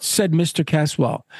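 usual speaking voice

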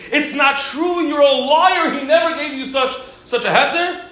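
high, loud accusing yell; retold screaming. Rabbi screams at the woman claiming a heter. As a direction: shout her down as a liar. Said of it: "he starts screaming at this Aguna".

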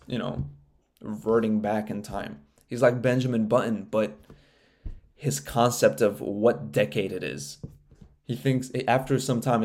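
ordinary delivery

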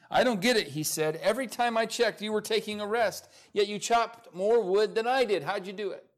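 high, loud, baffled protest; acted-out exasperated challenger demanding an explanation; surprised and annoyed. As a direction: complain in disbelief, voice pitched well up. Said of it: "the challenger was surprised and annoyed".

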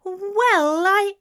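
high, loud, offended splutter; affronted. A narrator's reading. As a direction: start to protest, highly offended.

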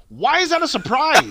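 retelling his reply to a surprised doctor, indignant; indignant retort. Retold or imitated. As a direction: throw the doctor's surprise back at him. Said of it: "And I was like".

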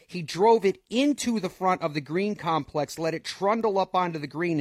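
excited. Real, not acted. Calling Rory's great approach shot.

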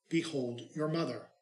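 ordinary talk

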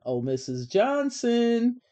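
high, drawn-out, almost sung call; singsong call. A narrator's reading. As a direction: call out to the aide in a slow lilt. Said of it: "Her voice was almost singing".

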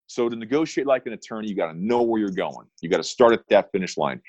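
ordinary talk, nothing marked

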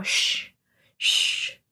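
unvoiced shh; hushing. Jenny's mom hushing the principal, a breathy shh.

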